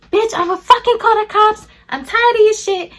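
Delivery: high, loud mock-furious shout; mother's furious yelling. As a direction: imitate mom hollering threats after you. Imitating mom's threats as she flees.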